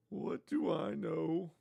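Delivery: high, soft, drawn-out silly voice; mock clueless. Host mocking himself for judging rich people, mock clueless. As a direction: stretch it out in a high put-on voice. Repeats it in a put-on voice.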